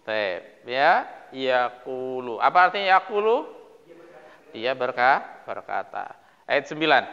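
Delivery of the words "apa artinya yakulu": normal talking voice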